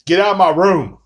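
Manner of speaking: loud, annoyed yell at a bug; yelling at the gnat. Real, not acted. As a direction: shoo the gnat away, loud and fed up.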